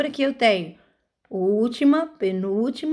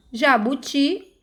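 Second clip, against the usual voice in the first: slow Jabuti with a long TI; teacher's demo, final TI stressed in Jabuti; stressed last syllable; 2 semitones higher, slower than usual. Shown as a demonstration. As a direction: draw out the end of Jabuti. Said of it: "ti é a última sílaba".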